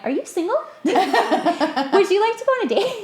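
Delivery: asked through a laugh, pitched well up; giddy, high, giggling question; playful laughing invitation; laughing mock ask. Asking old acquaintances out, laughing.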